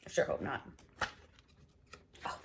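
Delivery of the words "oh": ordinary delivery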